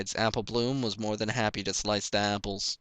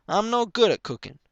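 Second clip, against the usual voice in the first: high, loud whining plea; whiny filly; 11 semitones higher, 7 dB louder than usual. A narrator's reading. Young Applejack whining to her mother. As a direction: plead to go outside.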